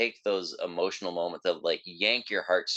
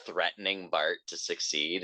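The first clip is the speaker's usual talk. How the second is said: ordinary talk, nothing marked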